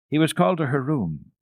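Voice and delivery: plain reading voice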